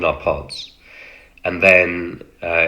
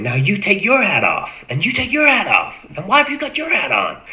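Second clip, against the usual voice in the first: much higher, building American orders; American officer accent; 14 semitones higher than usual. Retold or imitated. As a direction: hammer each extra in an American accent. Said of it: "he stayed in his American voice".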